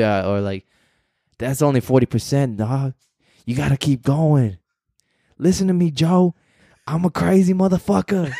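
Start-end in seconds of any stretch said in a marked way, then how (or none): 1.4-8.3 higher-pitched hard-driving motivator impression; David Goggins. Fan imitating his hero's forty percent speech, intense impression. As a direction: push like a relentless tough-love coach. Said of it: "I don't know why I'm talking like this guy".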